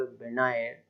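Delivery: "विनायर" with no g sounded; silent g. Shown as a demonstration. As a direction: say only "विनायर", g left out. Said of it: "हमारा जी silent हो जाएगा".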